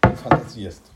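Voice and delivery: a sudden loud outburst; shouting. A narrator's reading. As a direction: shout him down, exasperated. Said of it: "rief er". (laut und empört ausgerufen)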